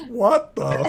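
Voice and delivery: high, loud startled outburst; stunned disbelief. Acted. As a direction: blurt it out as the baffled janitor. Janitor pony opens room, stunned.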